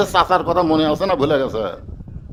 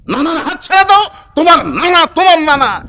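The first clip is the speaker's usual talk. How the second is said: high, raised-voice scolding order; acted sharp command; 10 semitones higher, 8 dB louder than usual. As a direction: repeat the order urgently at a high pitch. [name] আবার জোর দিয়ে বলছে. (চড়া গলায় ধমকের সুরে আদেশ)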